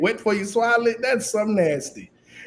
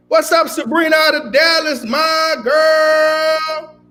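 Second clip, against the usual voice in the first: shouting greeting; 6 semitones higher, slower than usual. Real, not acted. Calling out to caller.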